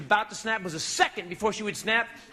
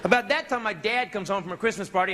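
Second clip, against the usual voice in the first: ordinary delivery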